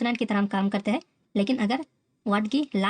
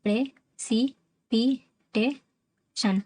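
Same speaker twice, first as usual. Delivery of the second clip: "Precipitation" slowly, each of five syllables apart; teacher slowly breaking "precipitation" into beats; much slower than usual. Shown as a demonstration. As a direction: count out "pray", "सी", "पी", "टे", "शन". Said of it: "पांच syllable हो रहा है".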